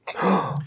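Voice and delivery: short, soft shocked gasp; theatrical intake of breath; mock gasp. Mock shock at the radio report.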